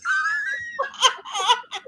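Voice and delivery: high, gasping laughter; real squealing laugh; laughing fit. Real, not acted. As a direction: crack up at your own joke. Cracks up at booby thetans joke.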